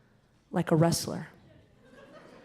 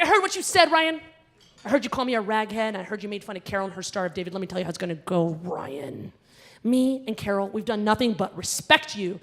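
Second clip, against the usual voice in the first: furious confrontation; 6 dB louder than usual. Retold or imitated. Confronting the bully Ryan.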